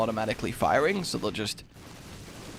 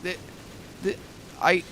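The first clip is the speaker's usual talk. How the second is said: stammering, with long gaps; acted lost for words, halting starts; hesitant; 4 semitones higher, 7 dB quieter than usual. Customer asked for his parents, acted flustered hesitation. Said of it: "in my moment of hesitation".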